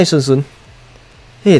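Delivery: ordinary delivery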